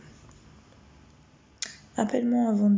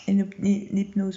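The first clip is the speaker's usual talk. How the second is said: ordinary delivery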